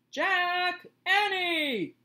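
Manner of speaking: loud, high call across the yard. Acted. Dad on the porch calling the kids, loud and high. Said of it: "he called".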